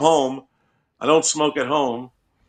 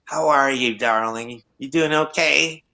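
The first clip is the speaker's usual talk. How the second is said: diner waitress. Retold or imitated. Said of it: "And then there's Sweet".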